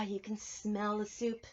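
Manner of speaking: ordinary delivery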